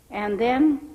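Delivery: usual speaking voice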